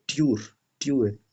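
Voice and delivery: ट्यूर with a rounded German ü; ट्यूर, short and loud, lips rounded; ट्यूर said as German Tür; German ü sound. Shown as a demonstration. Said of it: "यू umlaut को".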